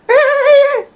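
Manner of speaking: high squeaky mock giggle; hammed-up squealing laugh; imitated giggle. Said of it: "అని నవ్వింది".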